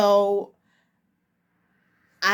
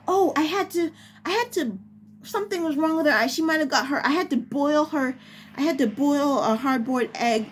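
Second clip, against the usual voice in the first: lightly raised, fretting imitation of the mother; worried mom; 6 semitones higher, faster than usual. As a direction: fuss over it like an anxious parent. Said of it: "the mom was just like".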